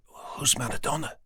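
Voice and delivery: soft, hushed question; whispering. A narrator's reading. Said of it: "whispered Laura".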